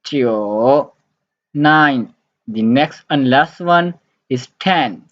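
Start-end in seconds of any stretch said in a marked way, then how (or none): 0.0-0.9 Jiu dipping low, then rising; dipping Mandarin tone on Jiu; third tone. Shown as a demonstration. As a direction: swing Jiu down and back up. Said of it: "in third tone".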